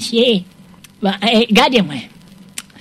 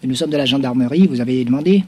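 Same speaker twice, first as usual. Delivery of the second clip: deep, firm official voice; low gendarme voice; 9 semitones lower, 3 dB quieter than usual. Acted. Gendarme à voix grave. (voix grave de gendarme)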